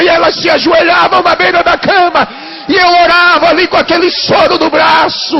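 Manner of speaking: loud, high, shouting sermon climax; preaching at full force. Acted. Pastor shouting the healing testimony to the congregation, full force preaching. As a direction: proclaim the miracle at the top of your voice.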